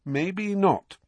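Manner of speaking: high-pitched, grudging concession; ominous but understated retort, pitched high; dark. A narrator's reading. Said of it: "she said darkly".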